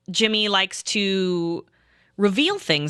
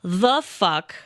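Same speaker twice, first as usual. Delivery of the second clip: understated mock exasperation with a pause mid-phrase; imitated pissed-off disbelief; much slower than usual. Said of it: "Pat was so pissed".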